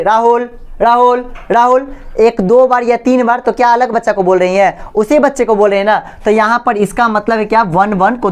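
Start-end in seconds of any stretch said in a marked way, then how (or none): 0.0-1.9 lightly acted roll call, the name repeated; ma'am calling roll. Teacher taking attendance in class, lightly acted calling out. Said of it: "तो ma'am बोले".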